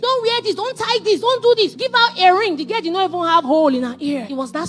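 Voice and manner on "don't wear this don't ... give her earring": higher, insistent retold orders; higher-pitched, pressing list of commands; insisting. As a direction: rattle off firm don'ts as an insisting husband. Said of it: "and he will insist".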